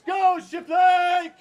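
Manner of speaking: huge high cheering scream; screaming from outside window. Real, not acted. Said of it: "screaming out of the window".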